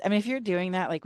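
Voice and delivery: normal talking voice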